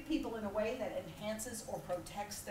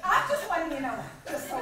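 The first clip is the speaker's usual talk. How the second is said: ordinary talk, nothing marked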